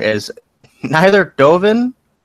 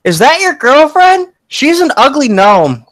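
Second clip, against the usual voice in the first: put-on high, loud little-kid voice; cheeky kid, pitched up; gnome child; 11 semitones higher, 5 dB louder than usual. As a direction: blurt it high like a tactless child. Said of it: "she looks over and says".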